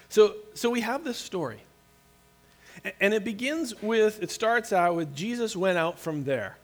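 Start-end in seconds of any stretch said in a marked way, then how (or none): none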